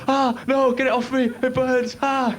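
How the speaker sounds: egg in pain. Pretend. The egg begs Ted to get the chili sauce off. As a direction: wail like a scalded egg.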